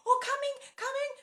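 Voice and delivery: high, loud sing-out to another room; calling out. Retold or imitated. Woman in the shower answers breakfast call, calling out.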